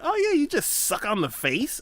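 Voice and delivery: high, mocking question; mock crawfish fan. Relatives explaining how to eat crawfish, mocking and high. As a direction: play the eager fan. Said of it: "And they're like".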